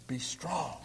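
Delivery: whispered verse words; a soft whispered charge to the church. Acted. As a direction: breathe it out as a hushed blessing. Reading closing verse softly.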